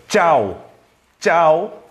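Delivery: "tchau" starting on a crisp tch; tch sound. Shown as a demonstration. Teacher showing how CH is pronounced, crisp tch sound. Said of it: "Tem essa pronúncia de tchá".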